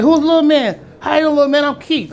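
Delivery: high, loud mock rock-star greeting. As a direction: play a delighted Keith greeting the boy, high and loud. Keith greets the boy.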